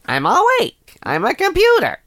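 high, loud robotic announcement; squeaky high machine voice waking; computer voice. Pretend. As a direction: play a cheerful computer booting up.

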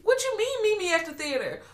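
high mock outrage at the film; incredulous high mockery. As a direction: scoff at the line in a high voice. Mocking a character's line.

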